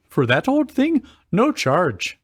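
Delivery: lightly voiced jolly shopkeeper. Voicing shopkeeper Oaken in story.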